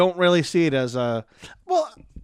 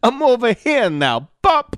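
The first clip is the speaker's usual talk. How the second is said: higher, punchy New York comic catchphrase; Andrew Dice Clay; 7 semitones higher, 5 dB louder than usual. Acted. Comic announcing he has moved over, mock New York swagger. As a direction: strut it like a tough-guy comic. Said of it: "Who should Andrew Dice Clay swap bodies with?".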